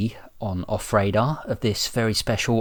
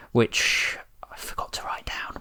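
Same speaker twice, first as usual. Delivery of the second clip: soft whispered aside; whispering; 7 dB quieter than usual. Acted. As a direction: hush it under your breath.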